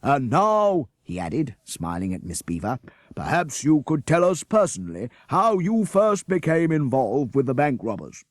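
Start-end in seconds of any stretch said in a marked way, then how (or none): 0.0-0.9 loud, slow, higher civic welcome; mayor hailing the class as heroes, booming. A narrator's reading. Mayor continues. 3.1-8.2 mayor hailing the class as heroes, booming. A narrator's reading. Mayor asks Miss Beaver.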